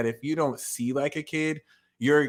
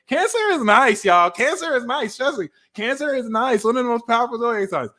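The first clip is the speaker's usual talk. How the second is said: way up high, sing-song insistence; insistent high reassurance; 10 semitones higher than usual. Acted. Reader to Cancer haters, high sing-song insistence. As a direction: chirp it brightly to convince them.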